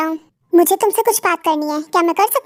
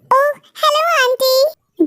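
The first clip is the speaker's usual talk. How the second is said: higher, very slow sweet greeting; girlfriend figure, sugary; 6 semitones higher, much slower than usual. Acted. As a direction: drag it out sweetly. (ऊँची, धीमी मीठी आवाज़)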